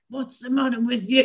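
sharp scolding of herself; a hint of a harsh inner critic. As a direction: snap at yourself like an inner critic. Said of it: "the dialogue and the tone was always like that".

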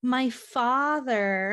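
mock innocent daughter. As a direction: play it coy and innocent, stretching the words slowly. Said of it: "And she's like".

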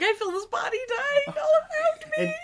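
high, crying, melodramatic voice; anguished lament. Retold or imitated. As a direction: wail about the mortal body. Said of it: "She's like".

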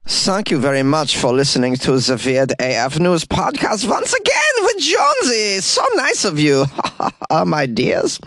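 swooping, very high-pitched gushing thanks; hammed-up thank-you voice. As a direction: gush to listeners in a put-on voice. Host puts on sign-off voice.

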